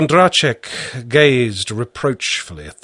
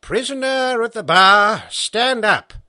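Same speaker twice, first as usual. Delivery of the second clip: raised, formal courtroom voice; stern judge; 11 semitones higher than usual. A narrator's reading. Judge orders the prisoner to stand, stern and raised. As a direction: command the prisoner to rise from the bench.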